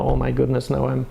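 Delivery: ordinary talk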